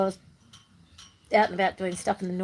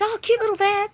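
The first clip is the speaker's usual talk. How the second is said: cooing lady; 13 semitones higher, 5 dB louder than usual. Retold or imitated. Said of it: "this lady goes".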